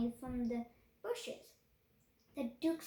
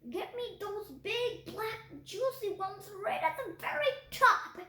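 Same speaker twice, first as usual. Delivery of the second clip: put-on raised, demanding old man's shout; 5 semitones higher, 3 dB louder than usual. A narrator's reading. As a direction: yell the order up the ladder. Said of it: "the older man was shouting".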